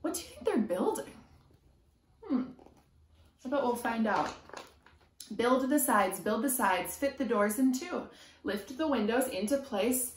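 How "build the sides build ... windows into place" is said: lively sing-song picture book reading; reading to children. A narrator's reading. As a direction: chant the rhyme to a small child. Reading rhyming picture book aloud.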